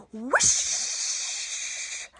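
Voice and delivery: long airy whoosh sound; breathy whoosh. Shown as a demonstration. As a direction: blow it out like wind. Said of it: "And a big".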